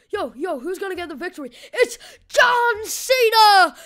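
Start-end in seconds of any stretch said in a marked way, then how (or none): 1.7-3.7 high, huge, drawn-out announcer yell; over-the-top wrestling intro shout; mock ring announcer. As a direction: bellow the entrance announcement, stretched out. Imitating wrestler's famous entrance announcement.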